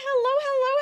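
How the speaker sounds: high, loud hello; bright excited welcome; excited greeting. Real, not acted.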